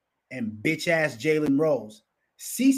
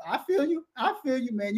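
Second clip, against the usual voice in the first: high, agreeable murmur; lightly voiced nervous agreement; mock nodding Skip; 9 semitones higher than usual. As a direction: imitate a man nodding along awkwardly. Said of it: "he just kept nodding like".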